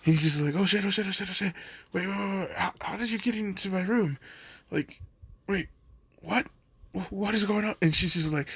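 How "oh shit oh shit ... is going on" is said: higher, tumbling panic; a flustered rush of alarm; panicked babble. Retold or imitated. Izuku caught in the dark, flustered and panicking.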